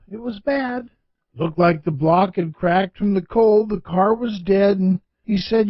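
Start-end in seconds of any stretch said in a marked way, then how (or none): none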